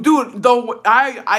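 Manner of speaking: loud, flustered false starts; stuttering. Retold or imitated. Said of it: "I had never stuttered so bad".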